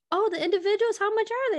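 high-pitched mock customer voice; inquiring customer. Walk-in customer asking about lash prices, high and inquiring.

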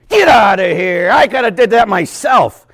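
loud, slow scoffing shout; mock indignant shout. Athlete dismissing a lazy coach, loud mock shout.

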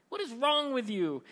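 high-pitched, mock-outraged question; appalled disbelief. Crowd challenges Jesus in disbelief.

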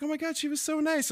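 very high mock gushing; high-pitched imitation of a charmed wife; oblivious delighted wife. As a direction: squeal it sweetly, oblivious.

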